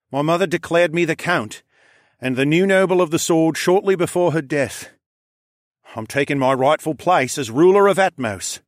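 a count declaring his rightful place; regal air. Acted. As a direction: claim the throne with regal pride. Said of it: "with the regal air of a ruler".

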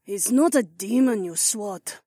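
high female voice, grumbled; grumbling. A narrator's reading. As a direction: grumble it, annoyed. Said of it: "Zoe grumbled".

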